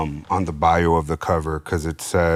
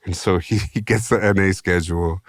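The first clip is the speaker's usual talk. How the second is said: normal talking voice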